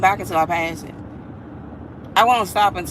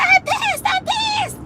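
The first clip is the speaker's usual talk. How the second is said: squealed high with joy; excited; slower than usual. Retold or imitated. Wife squealing to husband that she passed, overjoyed. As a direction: shriek it out, thrilled. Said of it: "I said".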